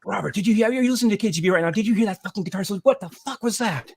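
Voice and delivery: high, fast, breathless excitement; teen calls friend Robert, high and fast with excitement; excited call. Retold or imitated. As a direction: gush to your friend about the solo.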